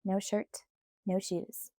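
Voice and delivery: usual speaking voice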